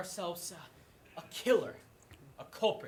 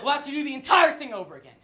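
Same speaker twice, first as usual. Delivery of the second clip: fast scolding; 4 semitones higher, 9 dB louder, faster than usual. Acted. Host scolding the crowd.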